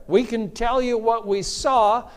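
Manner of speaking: emphatic preaching. Real, not acted. Preacher pressing the congregation on Peter's words. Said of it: "preaching at full force".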